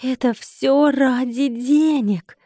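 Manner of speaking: very slow, stretched incredulous question; drawn-out dismay. A narrator's reading. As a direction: wail it slowly in mild despair. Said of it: "протянула я".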